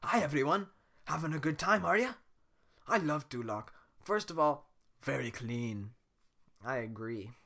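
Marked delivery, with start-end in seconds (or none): 0.0-5.9 Shrek voice. Acted. Shrek addresses the wedding.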